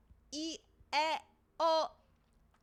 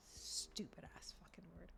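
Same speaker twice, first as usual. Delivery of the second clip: real whispered frustration; whispered curse; 8 semitones lower, 24 dB quieter than usual. Real, not acted. Mutters at the word.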